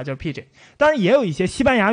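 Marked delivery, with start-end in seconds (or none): 0.0-0.4 soft repeat of pidgin. Shown as a demonstration. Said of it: "就直接简化了".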